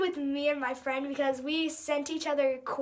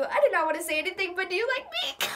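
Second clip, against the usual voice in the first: giggly rush; 3 semitones higher, faster than usual. Real, not acted. Reading crush note, giggling.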